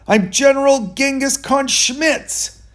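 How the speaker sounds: high, loud boast of his own name; bellowed. Acted. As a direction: announce yourself like a pompous general. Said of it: "He bellowed".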